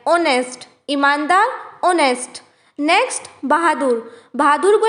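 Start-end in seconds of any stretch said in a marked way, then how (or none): 0.1-0.7 ऑनेस्ट said as "onest", no h sound; silent h. Shown as a demonstration. Teacher shows learners ऑनेस्ट with silent h, clear. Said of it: "यहाँ पर एच silent होता है". 1.8-2.4 ऑनेस्ट said as "onest", no h sound; silent h. Shown as a demonstration. Teacher shows learners ऑनेस्ट with silent h, clear. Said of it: "यहाँ पर एच silent होता है".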